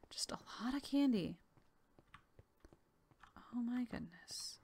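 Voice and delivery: soft awed whisper. Real, not acted. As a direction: breathe the words out in wonder. Quiet awe exploring the town.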